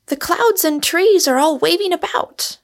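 high, excited character voice; high-pitched, stirred-up young woman. Acted. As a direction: exclaim at the stormy weather in a high pitch. Rosamond excited by the coming storm.

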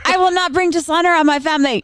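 high, emphatic hammed-up declaration; mock solemn vow. Co-host mocks family pressure, hammed-up vow. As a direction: swear it like a dutiful child. Mock dutiful child impression.

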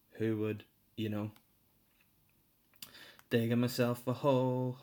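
ordinary talk, nothing marked

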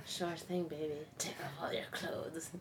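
mock sleazy murmur. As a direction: purr it like a creep in her ear. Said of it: "He's like".

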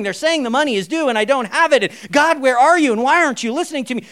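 a hint of a frantic begging believer; desperate pray-er. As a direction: whine at God as if everything's collapsing. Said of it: "It's like".

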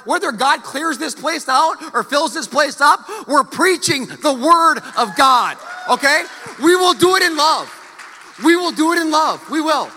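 loud, high preaching at full force, rising to a shout. Real, not acted. Pastor vowing to preach the Word, shouting. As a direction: proclaim it to the whole church.

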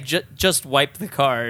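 slow, spelled-out repeat; slow deliberate echo. Shown as a demonstration. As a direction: drag the words out mockingly. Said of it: "Yeah, but just saying".

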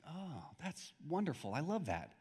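soft, hushed appreciation; reply quietly, as if moved by the saying; touched. Retold or imitated. His moved reply to the proverb.

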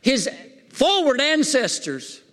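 high-pitched preacher in full flow, stretching the key words; fired-up preaching. Real, not acted. Preacher asking the congregation about David's blessed heritage, fired-up preaching. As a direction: preach it high, drawing out the big phrases.

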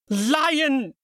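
one high, loud shouted word; angry shout. Acted. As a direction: hurl the word across the room. Shouting accusation during fight.

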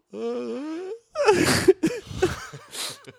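high, crying laugh that starts like a yawn. Real, not acted. Comic helpless with laughter among podcast friends, yawning cry-laugh. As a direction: laugh until it turns into weeping. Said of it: "That was a weird yawn cry laugh".

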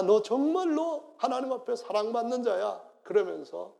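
loud, high impassioned preaching; preaching at full force. Acted. Preacher pleading Jesus' words to the congregation. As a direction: plead the Lord's love to them.

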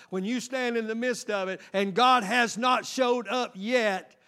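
loud, rising preacher's cry; full-force preaching. Acted. Preacher urging the congregation to fight, loud and driving. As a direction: drive the point home to the congregation, loud.

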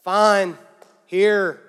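loud, grudging surrender; begrudging. Acted. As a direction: hand it over reluctantly and let everyone hear it. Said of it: "with a bad attitude, begrudging, reluctantly".

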